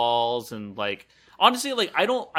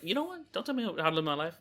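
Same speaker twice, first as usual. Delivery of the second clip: mock indignant; 6 semitones higher, 4 dB quieter, much faster than usual. Mock indignant reply to advice.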